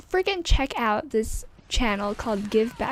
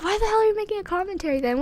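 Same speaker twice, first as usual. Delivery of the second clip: mock viewer; 10 semitones higher, much faster than usual. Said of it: "You're like".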